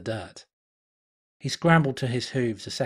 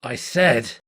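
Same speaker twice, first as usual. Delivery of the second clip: firm, louder warning; growl; 6 dB louder than usual. Acted. Stallion losing patience with the spirit, growling. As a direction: cut her off with a hard growl. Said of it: "he growled".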